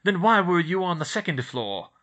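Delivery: sneering teacher closing in; Snape accusing. A narrator's reading. Snape cornering the children with a pointed question.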